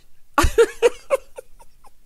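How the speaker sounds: real high-pitched cackle; high laugh. Real, not acted. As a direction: crack up helplessly, high. Cracks up at own imitation.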